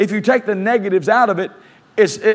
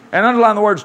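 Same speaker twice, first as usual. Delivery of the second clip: normal talking voice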